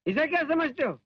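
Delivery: normal talking voice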